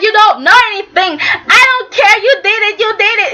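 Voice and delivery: high, crying shout; mock furious accusation. Nikki accusing Victor of the arrest, crying angry shout.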